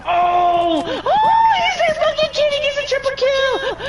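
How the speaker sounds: high, loud excited yell; excited shout. Real, not acted. Reacting to Kitty's triple kill in the match, excited high shout.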